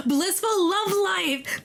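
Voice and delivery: gushing. Real, not acted. Gushing over in-game romance.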